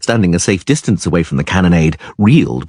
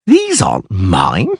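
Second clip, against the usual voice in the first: astonishment; slower than usual. A narrator's reading. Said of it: "said in astonishment".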